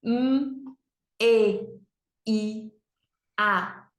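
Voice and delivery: M, E, I, A as "mmm, e, i, a"; letter sounds. Shown as a demonstration. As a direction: sound out each letter with pauses. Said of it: "quatro sonzinhos". (sonzinho de cada letra: "mmm, e, i, a")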